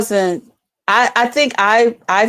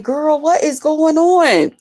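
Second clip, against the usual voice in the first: cousin on the phone; 6 semitones higher, slower than usual. Retold or imitated.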